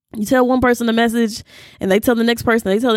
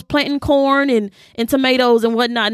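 ordinary talk

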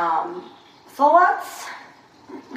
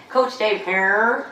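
slow, drawn-out hillbilly twang; much slower than usual. Retold or imitated. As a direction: stretch it out like a country boy. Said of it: "like, very redneck hillbilly".

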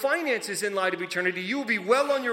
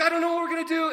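higher, panicky servant voice; freaking out; 8 semitones higher, faster than usual. Acted. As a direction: sound like the walls are closing in. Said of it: "he's freaking out about this".

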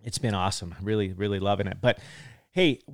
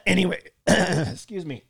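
clearing a rough throat mid-sentence; throat clearing, frog in throat; 5 semitones higher than usual. Real, not acted. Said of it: "I got a frog in my throat this morning".

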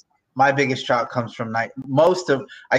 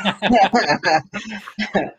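hearty laugh; 10 semitones higher, 3 dB louder than usual. Real, not acted. Laughs at own joke.

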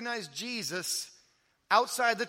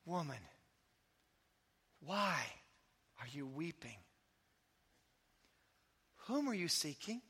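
gentle Jesus; 4 semitones lower, 8 dB quieter than usual. A narrator's reading. Jesus speaking to weeping Mary.

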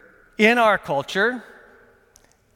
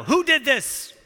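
a hint of an upset parent, pitched higher; lightly voiced worried parent asking who is to blame; parent demanding answers; 6 semitones higher, slower than usual. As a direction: demand to know who did it. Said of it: "As a parent, you wanna know".

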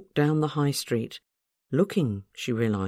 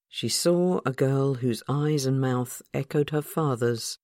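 plain reading voice

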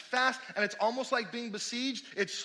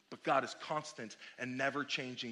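hushed; 9 semitones lower, 6 dB quieter than usual. Real, not acted. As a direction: drop low and soft, solemn. Preacher repeats, hushed and solemn.

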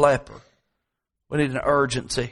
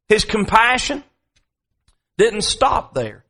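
preaching at full force; 4 semitones higher than usual. Acted. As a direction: drive the point home to the pews.

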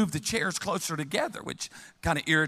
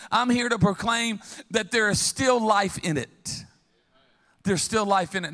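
proclaiming; 5 semitones higher than usual. Real, not acted. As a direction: proclaim it from the pulpit. Preacher proclaiming.

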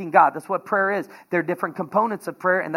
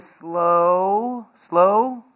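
drawn-out slow; much slower than usual. Shown as a demonstration. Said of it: "like easy".

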